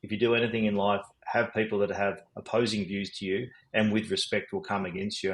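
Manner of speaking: ordinary talk, nothing marked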